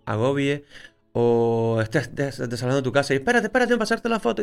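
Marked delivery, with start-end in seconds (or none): 3.2-4.4 much higher, a jab at a pushy photographer; mock pushy photographer. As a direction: imitate a photographer badgering a family. Mocking intrusive photographer.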